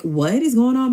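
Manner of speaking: high-pitched incredulous question; retold disbelief. Host confronting the chaos at her door, incredulous. Narrator's retold shocked reaction.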